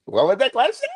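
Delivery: high, loud incredulous echo; mocking echo. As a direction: tease them with a high-pitched, laughing repeat. Mock echo of co-host's question.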